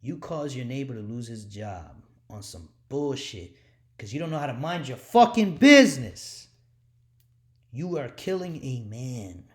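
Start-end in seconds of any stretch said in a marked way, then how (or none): 4.0-9.5 sudden roar, much louder, voice tearing; furious shout. Real, not acted. Preacher raging at coworkers who got him fired, furious shout. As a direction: explode at the busybody.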